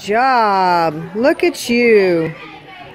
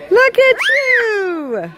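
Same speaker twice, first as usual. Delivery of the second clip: high, slow, laughing cheer; delighted laughing praise; 11 semitones higher, slower than usual. Real, not acted. Mother delighted at son balancing.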